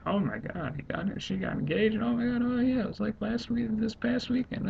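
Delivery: put-on very high, gushing girly squeal; squealed gossip in a mocking falsetto; mock girlish scream. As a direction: imitate a girl shrieking over engagement gossip. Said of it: "'cause the girl screamed".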